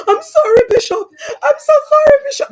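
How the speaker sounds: imitating begging. Grown man begging the bishop for forgiveness, hammed-up wailing sob. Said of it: "Like, begging".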